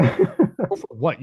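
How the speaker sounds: high-pitched mock outrage, breaking toward laughter; retold indignation. Arguing with a gunman over his phone, retold indignation. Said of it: "And I got mad".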